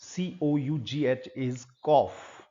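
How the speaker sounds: C O U G H spelled letter by letter, very slowly; slow spelling out. Shown as a demonstration. Teacher spelling cough slowly for learners. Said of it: "This word is".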